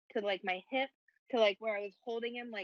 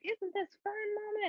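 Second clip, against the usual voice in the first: put-on much higher, sing-song kid voice; imitating her little son; 12 semitones higher than usual. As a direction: pipe it up like a cheerful four-year-old. Mom voicing son on the walk.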